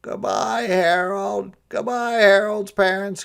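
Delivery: high, loud jolly farewell; cheery Santa goodbye. A narrator's reading. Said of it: "cried Santa".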